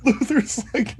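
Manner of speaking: ordinary delivery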